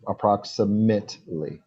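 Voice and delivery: "Approximately" slowly, ending mit-lee; correct pronunciation demo. Shown as a demonstration. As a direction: model "Approximately" slowly for a learner. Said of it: "pronouncing this wrong".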